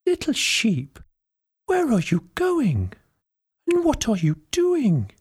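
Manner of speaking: put-on high, sweet old lady voice; kindly old lady. A narrator's reading. Old lady asks the lost little sheep, high sweet granny voice. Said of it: "asked the old lady".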